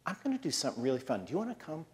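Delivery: soft, hushed invitation from Papa; softly coaxing; inviting Papa. Acted. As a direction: pitch it quietly, like a tempting offer. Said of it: "Papa says".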